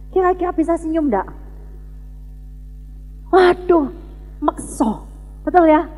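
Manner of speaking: usual speaking voice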